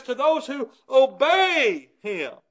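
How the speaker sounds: "To those who obey him" slow, every word leaned on; slow emphatic quote. Shown as a demonstration. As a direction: spell it out slowly for them. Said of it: "It says".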